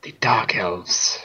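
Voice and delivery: slow hushed whisper of a beaten prisoner; whispering captive creature. Acted. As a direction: breathe the answer out slowly, cowed. Captured creature answers interrogation.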